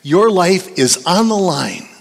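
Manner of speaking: emphatic pulpit delivery, hammering key phrases; forceful preaching. Real, not acted. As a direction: drive the warning home to the congregation.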